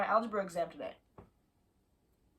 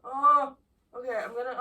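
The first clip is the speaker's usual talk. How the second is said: normal talking voice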